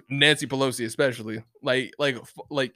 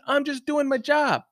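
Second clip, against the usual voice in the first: high-pitched, slowed mock quote; drawn-out, high imitation of an excuse; imitating cop's excuse; 11 semitones higher than usual. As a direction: lightly mimic a cop, pitched way up. Mimics Australian cop's stock excuse.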